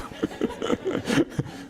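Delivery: a soft, high breathy laugh. Real, not acted. Pastor chuckling breathily at his congregation, high and soft. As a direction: laugh under the breath at the joke. Laughs while telling them stop.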